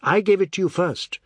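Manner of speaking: high, loud comeback; indignant retort. A narrator's reading. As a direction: claim it triumphantly over him. Tulsi tops him in quarrel.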